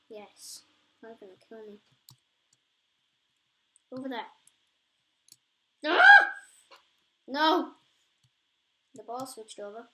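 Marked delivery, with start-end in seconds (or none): 5.9-6.3 sharp shriek of dismay, much higher and louder; sudden high scream. Real, not acted. Gamer watching his ball fall, high shriek. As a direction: yelp as the ball drops away.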